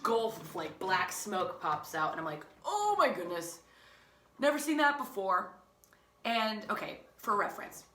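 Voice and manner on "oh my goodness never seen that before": high-pitched astonished reaction; shocked. Retold or imitated. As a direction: exclaim in acted shock.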